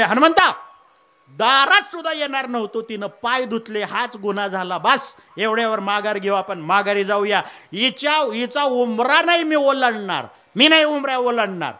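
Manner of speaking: angry refusal. Acted. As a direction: refuse hotly, digging in. Rama refuses to cross threshold.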